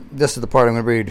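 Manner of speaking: ordinary talk, nothing marked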